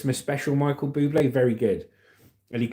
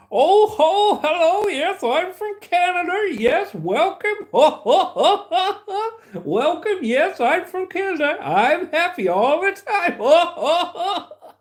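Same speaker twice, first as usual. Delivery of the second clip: very high, loud, hammed-up jolly Canadian accent; happy Canadian accent; 4 dB louder, slower than usual. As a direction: exaggerate a cheery Canadian accent with big ho-ho laughs. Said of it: "speaking the Canadian accent. And they're very happy".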